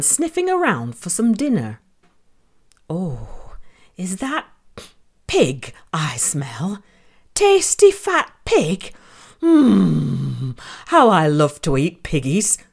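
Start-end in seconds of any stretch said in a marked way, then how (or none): 2.9-6.8 whispering. Acted. Wolf sniffing for dinner. 7.3-12.6 werewolf. Acted. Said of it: "cried the wolf".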